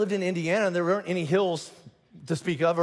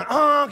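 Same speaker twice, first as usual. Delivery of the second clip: loud, high mock horn blast; sudden loud vocal car horn; imitated car honk; 8 semitones higher, 8 dB louder than usual. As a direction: honk like an impatient driver. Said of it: "and people go".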